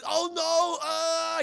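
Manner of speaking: high, loud falling cry; mock alarm. Hero flying out of control, mock alarm. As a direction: wail as if tumbling through the air.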